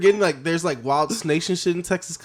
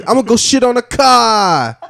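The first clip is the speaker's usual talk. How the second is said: put-on loud, high Boston accent; Boston pigeon; 9 semitones higher, 10 dB louder than usual. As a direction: shout it out in broad Bostonian. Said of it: "Like a Boston pigeon just says".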